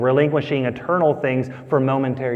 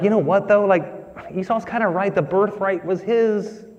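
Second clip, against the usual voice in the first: mock skeptical listener; 6 semitones higher than usual. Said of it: "we're like".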